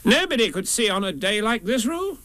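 high Rabbit character voice; fussy Rabbit. Acted. Rabbit dismisses Roo's complaint, high fussy voice. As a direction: pitch it up as Rabbit putting Roo off.

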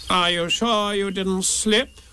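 mildly self-important, measured; wise Rabbit. Acted. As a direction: ask it slowly, as if being wise. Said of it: "asked Rabbit wisely".

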